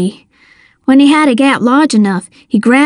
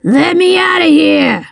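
higher, strained villain roar; trapped man's raised, angry cry; 5 semitones higher than usual. A narrator's reading. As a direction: roar to be let out, without full volume. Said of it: "Slate roared".

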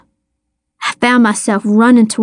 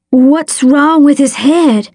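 high, slow, worried question; wavering voice; 4 semitones higher, slower than usual. A narrator's reading. Boy asking the doctor about his hurt brother, wavering and afraid. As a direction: ask it scared for your brother, voice unsteady. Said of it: "his voice wavering".

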